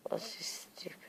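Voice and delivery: soft bedtime whisper. Real, not acted. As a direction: whisper so nobody wakes. Whispering late at night.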